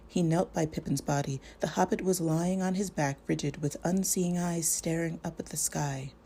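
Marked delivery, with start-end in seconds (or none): none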